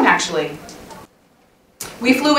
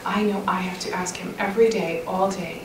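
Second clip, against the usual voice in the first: soft, breathy hush, barely voiced; hushed whisper; 12 dB quieter than usual. Real, not acted. Speaker whispering prompts during silent prayer, hushed. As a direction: drop to a whisper so they can pray. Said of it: "I'm just gonna quiet down here".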